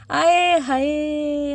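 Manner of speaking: drawn-out aaye haaye. Real, not acted. Delighted exclamation at the card.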